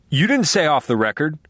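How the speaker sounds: blurted out. A narrator's reading. Reporter to LaGuerta, blurting in protest. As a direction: blurt it before you can stop. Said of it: "Rick Sangre blurted out".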